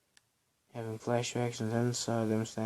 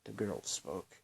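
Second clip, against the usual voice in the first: ordinary delivery, nothing marked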